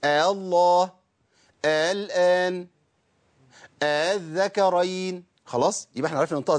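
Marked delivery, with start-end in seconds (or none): 0.0-0.9 آه الله with the second hamza eased, no long madd. Shown as a demonstration. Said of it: "وليا فيهم التسهيل زي أعجمي". 1.6-5.2 آه الله with the second hamza eased, no long madd. Shown as a demonstration. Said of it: "وليا فيهم التسهيل".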